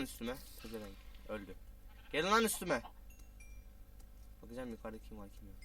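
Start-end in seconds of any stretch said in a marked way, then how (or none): 2.1-2.8 loud taunt; defiant shout. Real, not acted. Gamer taunting monsters to attack him, shouting. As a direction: call them on, daring. Challenging enemies.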